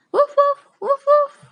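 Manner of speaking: high, loud yappy woof; dog bark. Pretend. Teacher imitating dog bark.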